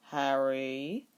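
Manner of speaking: put-on a mild warning to the child; reproving. A narrator's reading. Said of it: "Roy said reprovingly".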